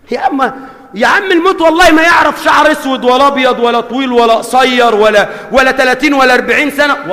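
loud, high fiery sermon; impassioned pulpit cry; shouting preacher. Acted. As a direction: thunder the warning at the crowd. Preacher shouting warning of death.

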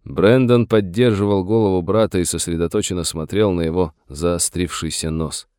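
plain reading voice

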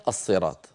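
"الصراط" with a heavy emphatic ṣ; majority reading. Shown as a demonstration. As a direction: thicken the first sound of "الصراط". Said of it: "رووها لنا صوتيا".

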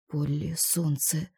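slow, soft mumbled words; mumbling. A narrator's reading. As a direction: mumble it gently to her. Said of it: "забормотал он".

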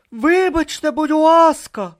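high, loud, drawn-out plea; high-voiced attempt to cut in; trying to interrupt. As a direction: call out politely over a quarrel. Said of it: "спробував утрутитися Бурута".

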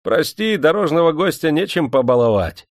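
a hint of an eager host. A narrator's reading. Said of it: "сказал цыган".

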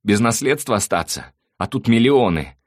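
loud, high-pitched wailing self-justification; anguished, whining outburst; tearful voice. A narrator's reading. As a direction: pour out excuses as if crying. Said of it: "плачущим голосом заговорил Слава".

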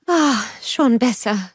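slow, sighing relief; relieved sigh. A narrator's reading. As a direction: breathe out as cool water soothes her feet. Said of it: "seufzte sie".

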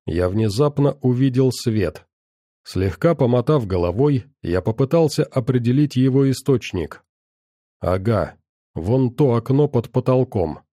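ordinary delivery, nothing marked

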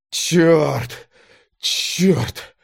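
strained, forced-out curse; curse through teeth. A narrator's reading. Exhausted driver slumping back in his seat, cursing tightly. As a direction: squeeze each curse through gritted teeth. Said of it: "цедил слова, откидываясь на сиденье".

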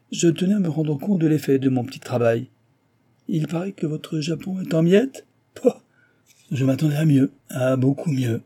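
high, breezy taunting; mocking engineer. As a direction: needle a rival with mock politeness. Engineer keeps taunting his rival.

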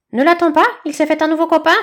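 high, loud cackling chick voice; rattle-voiced bird. Bird gloating to badger about his friend, loud and high. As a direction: squawk it out like a rattle. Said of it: "caquetait d'une voix de crécelle".

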